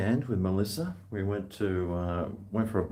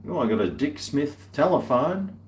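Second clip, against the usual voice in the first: usual speaking voice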